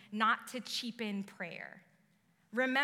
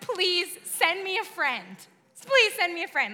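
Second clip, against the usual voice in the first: put-on high, loud plea like a desperate kid; begging; 7 semitones higher, 9 dB louder than usual. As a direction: plead hard, drawing out the first please. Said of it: "she's, like, begging".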